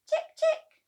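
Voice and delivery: very high sing-song call; calling the hen. A narrator's reading. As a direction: coax a hen to come. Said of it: "he said".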